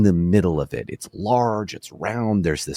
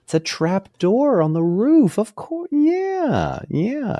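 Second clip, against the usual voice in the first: high, delighted aha; as if the penny just dropped; sudden realization; 13 semitones higher, slower than usual. Real, not acted. Suddenly gets the answer.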